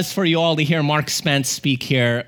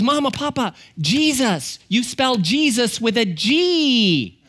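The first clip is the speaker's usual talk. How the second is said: put-on high, drawn-out little-girl voice; slow, high-pitched toddler voice; imitated toddler; 8 semitones higher, slower than usual. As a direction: play a small child proudly announcing a discovery. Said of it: "But she goes".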